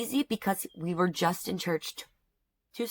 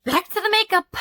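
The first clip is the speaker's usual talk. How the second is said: much higher, loud, bright switch; chirpy reset; 13 semitones higher, 9 dB louder than usual. Real, not acted. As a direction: snap back to cheerful. Bright switch back to tutorial.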